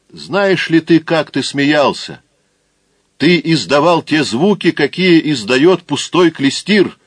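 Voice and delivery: high, loud scornful jeer; scornful taunt. Acted. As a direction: sneer at how he laughed. Taunting a man who laughed.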